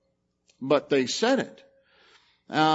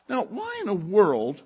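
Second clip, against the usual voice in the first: ordinary talk, nothing marked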